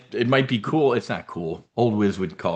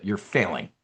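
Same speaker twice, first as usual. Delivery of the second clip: normal talking voice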